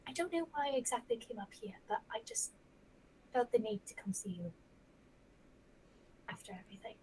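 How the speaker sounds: soft unvoiced confession; whisper. Arya whispers to the resting Zofiel, unvoiced and tender. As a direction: whisper it gently, as if the room might hear.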